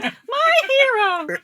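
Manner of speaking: very high, slow, loud swooning damsel. Pretend. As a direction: sigh it like a lovestruck heroine. Damsel thanking the hero.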